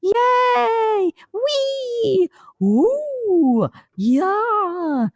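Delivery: very high, squeaky bubbly cries; tiny bubbles popping with glee; little bubble voices. Acted. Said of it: "like a little bubble going".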